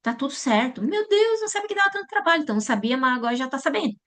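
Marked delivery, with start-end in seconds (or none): 0.8-2.4 very high, exasperated moan about the work; mock a parent surprised by the effort; whiny complaint.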